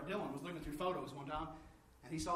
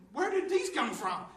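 high, loud and slow in wonder; amazed question; 9 semitones higher, 8 dB louder, slower than usual. Retold or imitated. Finding a box of books in a closet, amazed and loud. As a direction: ask it as if you can't believe it.

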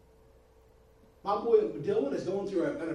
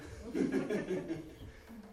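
chuckle; 3 semitones higher, 3 dB quieter than usual. Real, not acted. Laughs after asking for forgiveness.

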